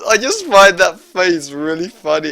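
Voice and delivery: loud, high helpless laughter through the words; laughing. Real, not acted. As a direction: talk through a real fit of giggles. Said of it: "I don't know why I'm laughing".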